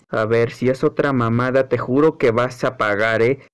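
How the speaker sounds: very low threatening voice; menacing threat. Acted. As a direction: drop deep and warn them. Threatening figure speaks.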